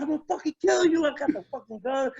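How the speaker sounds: a retold rage, pitched high and quick; retold furious threat. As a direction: snap it out like you mean to pull the trigger.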